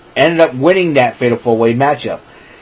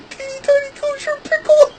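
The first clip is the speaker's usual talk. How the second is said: squeaky giggle. Real, not acted. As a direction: squeak it while trying not to laugh. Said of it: "I better not laugh".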